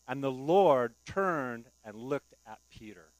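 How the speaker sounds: loud and slow, each word weighted; solemn slow scripture emphasis; dramatic reading. Acted. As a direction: let the moment land heavily.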